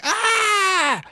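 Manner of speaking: high startled shriek from the room; startled scream. Retold or imitated. The class startled by his yell, a high shriek. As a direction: everyone jumps and shrieks. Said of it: "And everybody's like".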